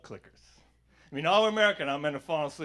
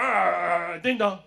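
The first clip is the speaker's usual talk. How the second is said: mock doorbell alarm; 8 dB louder, much slower than usual. Doorbell interrupts the fight, loud mock alarm.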